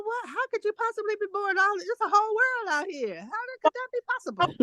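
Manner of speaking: high-pitched incredulous outburst; exaggerated, high astonishment; mock disbelief. Acts out her disbelief at boredom.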